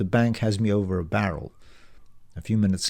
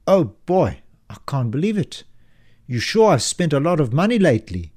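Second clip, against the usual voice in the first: lightly voiced banker, higher and louder in disbelief; a shocked bank clerk who can't believe the figures; aghast banker; 5 semitones higher, 5 dB louder than usual.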